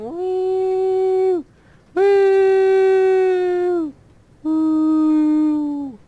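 high, loud whooping; joyful woo. Real, not acted. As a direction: whoop like on a rollercoaster. Riding the minecart.

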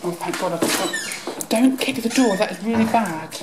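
ordinary talk

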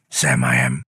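usual speaking voice

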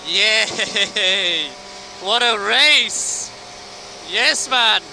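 loud, slow, drawn-out cheer; excited cheering. Real, not acted. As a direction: cheer with delight. Driver celebrating the race.

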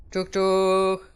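drawn-out level choo-choo; train imitation. Player sets off by train, playful train noise. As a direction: chug along like a little train.

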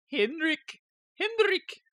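high-pitched attempt at a Swedish accent; mock Swedish accent. Streamer guessing how Swedish viewer says his name, mock Swedish accent. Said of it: "Is that what Swedish people sound like?".

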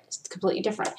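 whispers the repeat to herself; breathy aside, barely voiced; whispered echo. Real, not acted. Echoes the phrase quietly.

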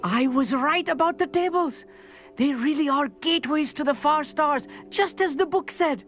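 high-pitched, elated announcement; excited discovery. Acted. Stargazer tells companion the tables work, excited. Stargazer's theory has just proved true.

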